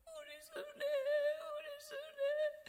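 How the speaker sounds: very high, slow, sing-song crying; keen the name through tears, drawn out; mother weeping. Retold or imitated. Mother keening her daughter's name, high and tearful. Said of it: "엄마가 그냥 이유 없이 계속 울어요".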